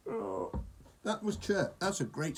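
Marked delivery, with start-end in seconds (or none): none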